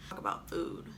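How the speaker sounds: whispered note to self; quick private whisper, off-mic. Real, not acted. Whispered reminder to herself.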